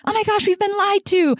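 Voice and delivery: very high-pitched imitation of outrage; playful squeal of mock indignation; mock outraged rant. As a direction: re-enact your fired-up rant way up high. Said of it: "you can tell I was on a rant".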